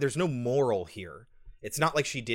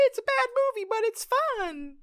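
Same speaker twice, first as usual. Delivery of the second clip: much higher, slowed, silly sing-song voice; mocking fan voice. Mocking audiences who call it a fun bad movie, high and sing-song. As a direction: parody a gushing fan defending the film. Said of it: "people that are like".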